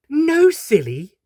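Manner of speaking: very high, slow teasing voice. Acted. Doctor correcting the horse, high slow teasing. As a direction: tease him sweetly up high.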